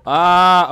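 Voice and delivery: sudden loud gasp; startled yelp. Real, not acted. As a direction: jump at the sight. Startled by boss appearing.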